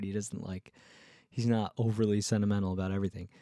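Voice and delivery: ordinary talk, nothing marked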